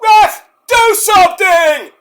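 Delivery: a high, loud, desperate yell at the ref; shouting at the ref. Retold or imitated. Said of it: "is like".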